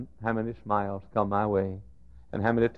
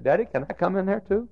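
put-on higher child's voice asking; little girl's plea; 10 semitones higher, 4 dB louder than usual. Daughter asking father to join, higher child voice. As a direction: ask like a small girl wanting in. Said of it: "She said".

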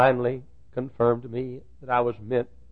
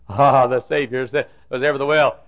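ordinary talk

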